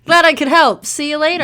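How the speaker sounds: loud, high mock-chipper farewell; sarcastic chirpy goodbye. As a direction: friend mocking the awkward exit, bright sarcasm. Mocking the hasty exit.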